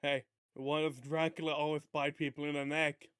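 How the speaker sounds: ordinary talk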